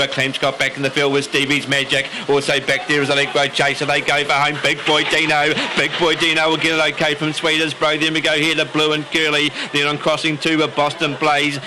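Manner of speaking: rising, urgent race commentary; live race call. Acted. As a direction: call the race as it unfolds, building.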